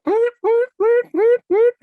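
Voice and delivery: high, loud whooping siren noise; car alarm imitation. Telling about alarms on the ferry, loud high car alarm whoops. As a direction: mimic a car alarm wailing. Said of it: "this car alarm would go off".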